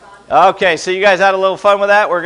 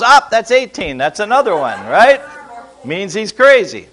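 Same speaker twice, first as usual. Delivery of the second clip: ordinary talk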